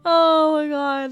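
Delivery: ordinary talk, nothing marked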